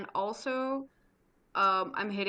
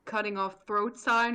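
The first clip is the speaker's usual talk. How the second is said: ordinary delivery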